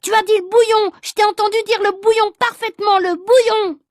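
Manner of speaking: put-on high, loud squabbling boy; heated accusation. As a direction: hammer the accusation home. Boys shouting accusations.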